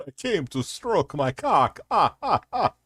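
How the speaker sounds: mock Dracula moaning, rising at the end; hammed-up vampire count; Count Fapula. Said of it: "It's Count Fapula".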